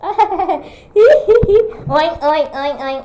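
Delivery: high, laughing oinks; playful piggy oinking. Acted. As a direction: snort out piggy oinks, giggling. Playing Peppa Pig.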